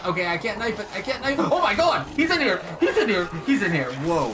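panicked high screaming; yelling in real fright, laughing; adrenaline rush. Real, not acted. Monster bursts into the room.

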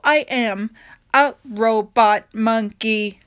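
ordinary delivery, nothing marked

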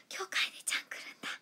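fast breathy whisper of a private thought. Retold or imitated. Idol recalling her thought about a fan, whispered. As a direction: whisper it to yourself, excited and quick.

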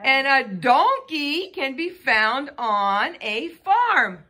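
ordinary delivery

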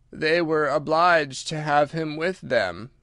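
slow, drawn-out creature voice; Mock Turtle, ponderous. Mock Turtle explains about the porpoise, slow and ponderous. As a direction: explain it in long, dragging words.

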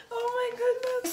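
high, teary oh my goodness; choked up with delight; teary joy. Real, not acted. Overwhelmed by the gift.

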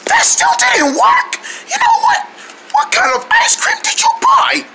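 high, croaky indignant complaint; lost voice. Acted. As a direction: complain through a wrecked throat. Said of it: "why do you sound like that?".

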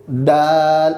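দাল with the long vowel held; drawn-out letter. Shown as a demonstration. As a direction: stretch out the middle of দাল. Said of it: "একটু টেনে বলবো". (দাল একটু টেনে বলা)

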